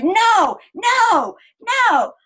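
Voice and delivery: high, loud upset scream of no; screaming child. Retold or imitated. Daughter screaming that her sister wears her shorts, high shriek. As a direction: wail it out like a frantic child. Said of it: "she's screaming and screaming".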